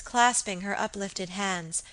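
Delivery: ordinary delivery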